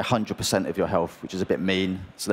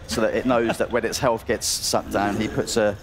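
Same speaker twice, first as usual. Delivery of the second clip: ordinary delivery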